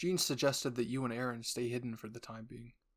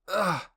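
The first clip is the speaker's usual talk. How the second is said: loud, higher grunt of pain; 6 semitones higher, 10 dB louder than usual. Acted. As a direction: grunt as the steam hits. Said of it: "you grunted".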